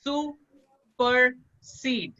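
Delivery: सुपरसीड split slowly into three separate syllables. Shown as a demonstration. Teacher showing students the three syllables, spoken syllable by syllable. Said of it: "three syllable में रखते हैं".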